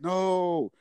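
high, drawn-out mock "no"; scared kids' protest. Voicing scared kids.

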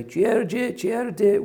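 high, loud hawker's cry, rising on the first call; street vendor's call. Retold or imitated. Judge made to hawk tripe in the marketplace, loud high vendor cry. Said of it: "which is what the tripe sellers say".

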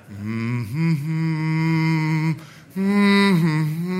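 soft timid hum to avoid trouble; nervous humming. Retold or imitated. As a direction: hum quietly and walk away. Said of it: "let's just hum and walk away".

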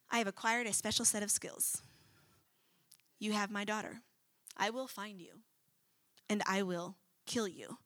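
low, quiet, menacing threat; calm, deadly promise without yelling; Liam Neeson in Taken. Retold or imitated. Preacher imitating Liam Neeson's quiet threat to his daughter's kidnappers. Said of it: "I hear Liam Neeson from Taken".

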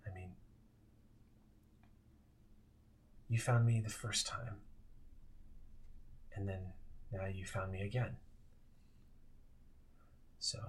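soft, fading ghost. Acted. [name], fading ghost, speaks softly.

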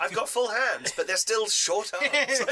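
laughing through a mock dinosaur's complaint; T-Rex complaining. Game master voices a short-armed T-Rex, laughing mock complaint. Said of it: "He'd be like".

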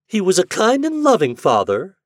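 high, loud mocking praise. A narrator's reading. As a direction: sneer the compliment. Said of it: "her voice mocking".

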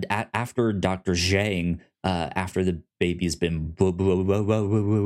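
ordinary talk

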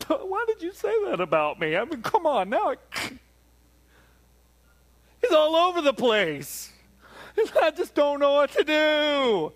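high, loud, wounded whine; whiny hurt God. Retold or imitated. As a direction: pout like a moody God who can't decide. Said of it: "goes over there and goes".

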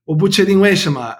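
normal talking voice

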